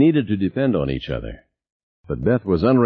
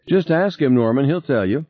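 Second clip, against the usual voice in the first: high-pitched, insistent urging; defensive push, voice lifted high; 7 semitones higher than usual. A narrator's reading. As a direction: urge him to check, pitch well up. Acted dispute over the intercom.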